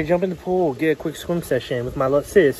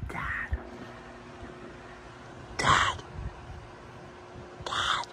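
soft, hushed whisper; quiet, unvoiced call to a sleeper; whispered waking call; 15 dB quieter, much slower than usual. Real, not acted. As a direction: whisper repeatedly to wake dad.

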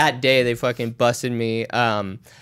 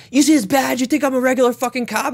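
aggressive cop; 10 semitones higher than usual. Retold or imitated. Cop yanks him from car.

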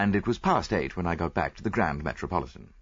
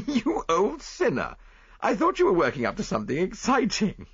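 laughing tease; 12 semitones higher, 3 dB louder than usual. Acted. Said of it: "I laughed".

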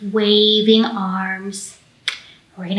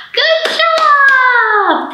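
high, bright cheer; excited praise; 3 dB louder than usual. Real, not acted. Librarian cheering the kids after the song.

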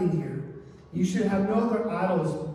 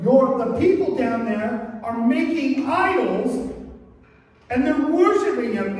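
loud, high-pitched indignant report; angry God; 6 semitones higher, 6 dB louder than usual. Acted. God tells Moses of the idol worship, angry. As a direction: raise the voice as God informing Moses, angered. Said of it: "it, he became angry".

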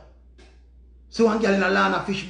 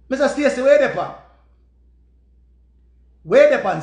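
calling out; 4 semitones higher, 6 dB louder than usual. Retold or imitated. Demanding the pan from Stacy.

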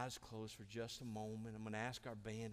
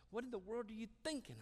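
high, quick incredulous question; exasperated, high-pitched challenge; 11 semitones higher, faster than usual. Acted. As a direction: ask it in disbelief, pitched right up. God voiced as incredulous at Elijah.

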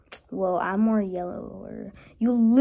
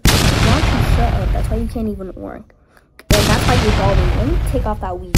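mock argument; 8 dB louder than usual. Eraser characters quarreling.

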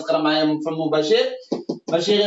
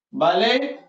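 ordinary talk, nothing marked

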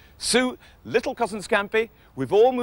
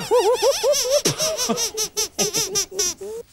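puppet giggle, high and gleeful; squeaky high giggle; 13 semitones higher than usual. Acted. Cheered-up Sooty giggles at Matthew's expense. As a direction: giggle with delight. Sooty laughs after the trick.

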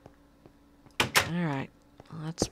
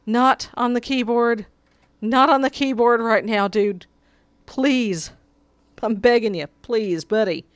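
raised, higher desperate begging; pleading; 4 semitones higher, 6 dB louder than usual. Real, not acted. Streamer begging his cat to get off the keyboard, pleading loudly. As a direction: beg the cat loudly, voice pitched up.

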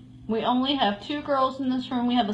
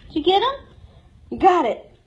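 normal talking voice

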